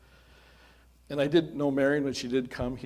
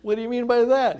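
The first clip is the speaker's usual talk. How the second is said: much higher and louder, taken aback; mock surprise; 11 semitones higher, 10 dB louder than usual. Pastor retelling his startled answer to Jeff, high and loud. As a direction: act startled and puzzled.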